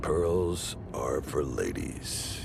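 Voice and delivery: drawn-out, low, weighty warning; slow emphatic rebuke. Acted. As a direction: stretch every word to drive it home. Repeats rebuke slowly, with weight.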